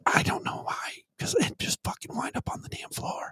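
breathy mock-ASMR whisper. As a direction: whisper it close to the mic, hammed-up. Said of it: "I'm just gonna go A S M R".

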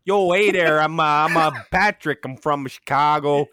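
put-on loud, high Chicago accent; big hammed-up Chicago accent. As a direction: sober Chicago cop voice, pushed loud. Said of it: "a Chicago accent when he's sober".

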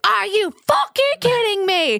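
high, drawn-out imitation of an outraged scream; mock screaming. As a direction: screech it slowly, mimicking a furious stranger. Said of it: "Starts screaming".

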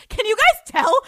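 high, loud giddy burst through a laugh; really excited. Real, not acted. Asking listeners if they notice, bursting with excitement. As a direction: squeal it out, can't contain yourself. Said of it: "I'm just really excited".

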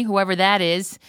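usual speaking voice

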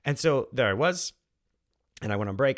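ordinary talk, nothing marked

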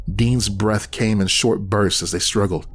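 ordinary delivery

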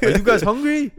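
high, loud, hammed-up French accent; bad French accent. Host repeats billet lady's question, mock French accent. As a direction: repeat it as a badly imitated Frenchwoman, voice way up. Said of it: "Can't do a French accent".

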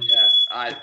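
ordinary delivery

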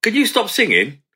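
high mock-polite staff woman; staff woman asking. Theatre worker telling him to stop singing, mock-polite. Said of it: "She went".

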